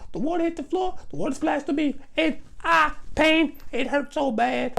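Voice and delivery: put-on high, fast child voice telling on sister; high child snitching on his sister; tattling little kid. Boy snitching to mother.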